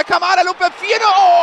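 high excited goal call; screaming goal commentary. Real, not acted. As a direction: shout the fourth goal. Fourth goal called.

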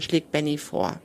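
plain reading voice

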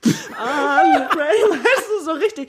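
hoch und übertrieben leidend wie im Musikvideo; theatralisch. Pretend. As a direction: dramatisch gespielter Herzschmerz, höher. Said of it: "Schön theatralisch". (theatralisch leidend)

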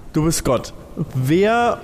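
ordinary delivery

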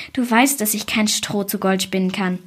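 plain reading voice

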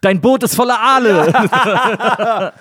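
slow, laughing, put-on voice; mock dramatic line. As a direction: drag it out slowly through laughter.

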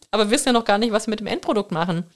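ordinary talk

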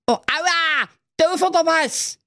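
very high, tiny complaining candy voice; little bait sweet griping in pain; squeaky gummy bear. Acted. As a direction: whine like a gummy bear stuck on a hook.

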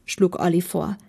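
ordinary delivery

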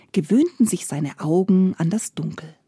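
usual speaking voice

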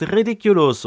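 a light, playful spellcasting cry; spell cry. Retold or imitated. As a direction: fling the spell out like a nervous kid. Neville casting the spell.

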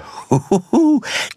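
very high bouncy cartoon laugh; giggling cartoon tiger; Tigger laugh. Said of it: "sprechende Puppe".

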